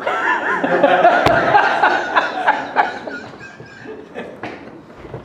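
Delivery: long high laugh. Real, not acted. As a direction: laugh at your own foolishness. Laughing at the story.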